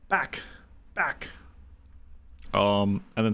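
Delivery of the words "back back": mock hero warding off. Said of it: "hold out your cool shiny dagger and go".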